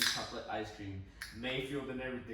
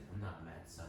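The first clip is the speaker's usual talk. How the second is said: low disappointed dad; 6 semitones lower, 10 dB quieter than usual. Retold or imitated. Dad's disappointed voice.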